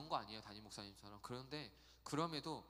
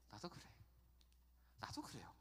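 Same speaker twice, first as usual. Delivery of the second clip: fading to a whisper; 5 semitones lower, 10 dB quieter than usual. Acted. Whispered agreement to the congregation.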